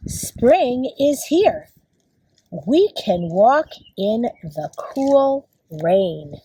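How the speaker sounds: slow, measured picture-book reading; reading to children. Acted. Teacher reads a spring book to kids, slow read-aloud. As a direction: read slowly, each word spaced out for young children.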